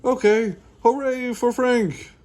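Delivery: high, loud hooray; boisterous high-pitched celebration; yelled cheer. A narrator's reading. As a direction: cheer at the top of your voice. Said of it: "yells Bean".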